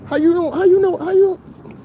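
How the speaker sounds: very high, squeaky disbelief; incredulous squeak. Acted. Scoffing at the woman's logic, very high squeaky disbelief. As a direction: squeal the question in exaggerated disbelief. Mocking her reasoning.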